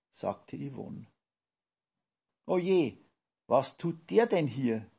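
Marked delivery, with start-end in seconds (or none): 2.5-4.9 higher, slightly louder call; a dismayed little outcry; alarmed exclamation. A narrator's reading. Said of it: "rief Lydia".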